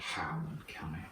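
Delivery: "Highland County" breathed softly, leaning on the H in a rural twang; accent demo, hushed. Shown as a demonstration. Friends trying county names in an accent, hushed. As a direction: savour the H of "Highland County" in a whispered local accent. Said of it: "that H is fun to hit in that accent".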